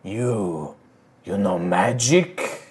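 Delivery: slow shaman voice. Acted. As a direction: ask as a shaman, weighing each word. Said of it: "The shaman looks, and he".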